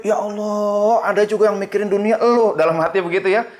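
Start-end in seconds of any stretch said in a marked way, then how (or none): 0.0-2.2 higher-pitched, mildly shocked disciple; an incredulous inner exclamation, pitched up; taken aback. Acted. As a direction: react in startled disbelief. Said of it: "Kaget itu murid".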